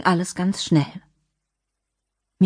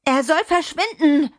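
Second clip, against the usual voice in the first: put-on much higher, angry child's outburst; furious child; 10 semitones higher than usual. Adriana with balled fists defying the man, furious. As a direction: demand it in a high, defiant voice.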